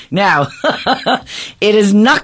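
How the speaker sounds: bursting into a high laugh. Real, not acted. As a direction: laugh at the family joke. Laughs at dad's promise.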